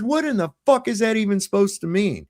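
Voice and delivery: high-pitched disbelief; retold indignant question. Father challenging newly arrived cop, retold indignant question. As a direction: challenge him, pitched well up.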